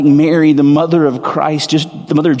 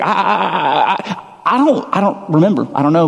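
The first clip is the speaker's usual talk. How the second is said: flustered stammer; 3 semitones higher than usual. Retold or imitated. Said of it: "I was like".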